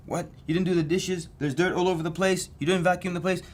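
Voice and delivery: mock nagging. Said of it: "when she starts nagging him".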